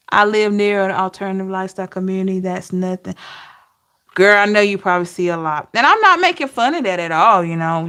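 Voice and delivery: usual speaking voice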